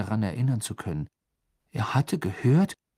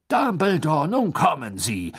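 loud, indignant protest; raised, exasperated appeal; agitated; 7 semitones higher, 6 dB louder than usual. A narrator's reading. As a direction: cut in, worked up and impatient. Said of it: "sagte Fudge aufgebracht".

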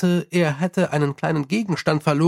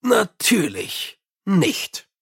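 firm refusal; 4 semitones higher, much slower than usual. Acted. Mumm refuses the imp.

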